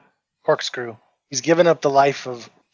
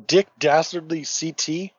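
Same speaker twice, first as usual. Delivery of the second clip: ordinary delivery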